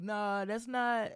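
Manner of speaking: the whole panel protesting together; chorus of objection. Retold or imitated. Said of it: "everybody was like".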